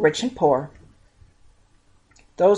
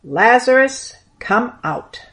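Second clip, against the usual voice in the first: louder, higher call of the name; commanding call; 5 semitones higher, 3 dB louder, slower than usual. A narrator's reading. Jesus calling Lazarus from the grave, loud command. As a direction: call him out of the tomb. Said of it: "Jesus commanded".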